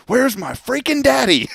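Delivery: high-pitched mock yell of a frustrated little girl; imitated daughter's yell. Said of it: "so she yells out".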